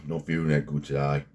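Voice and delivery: put-on a loose, half-failed Australian accent; Ivan Jerk Australian accent. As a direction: imitate a lifter's Australian accent, sliding toward English. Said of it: "I can't do an Australian accent anymore".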